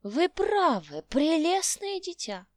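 put-on loud, slow high old man's cry; exclaiming old man. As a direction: cry out delighted like an old geezer. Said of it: "вскричал старик".

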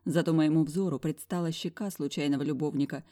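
ordinary delivery, nothing marked

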